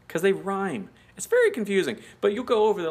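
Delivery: usual speaking voice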